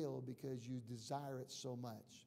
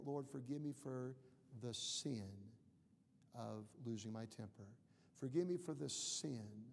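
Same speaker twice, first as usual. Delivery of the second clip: soft prayer with weighted pauses; solemn confession; 4 semitones lower, 6 dB quieter than usual. Acted. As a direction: confess each sin quietly, pausing after SIN. Models naming sins in prayer.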